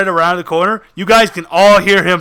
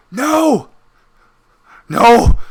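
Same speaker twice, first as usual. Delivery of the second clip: high-pitched, mildly desperate no; pleading refusal; 4 semitones higher, 4 dB louder than usual. Acted. Captive begging the one gripping his hands, pleading refusal. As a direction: cry out a short, high refusal. GM voices cornered creature refusing.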